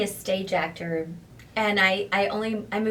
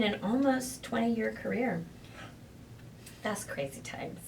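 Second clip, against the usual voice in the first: ordinary delivery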